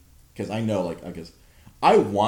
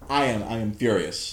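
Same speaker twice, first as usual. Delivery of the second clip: normal talking voice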